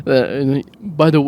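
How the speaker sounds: usual speaking voice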